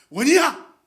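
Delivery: loud high call of a name. Real, not acted. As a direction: call him across the room. Calling a congregant by name.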